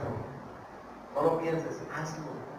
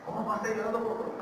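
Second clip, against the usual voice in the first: quick, raised-pitch puzzled question; quieter disbelief; 6 semitones higher, 3 dB quieter, faster than usual. Acted. Visitor repeating the question to the rancher, mildly incredulous. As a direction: puzzle over it without raising the volume.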